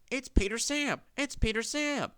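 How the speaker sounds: high, pleading wail. A narrator's reading. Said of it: "wailed the empty trucks".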